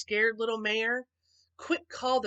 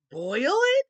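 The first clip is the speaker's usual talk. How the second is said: high, loud alarmed gasp; shocked disbelief; 4 semitones higher, 8 dB louder than usual. A narrator's reading. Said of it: "gasped Horton".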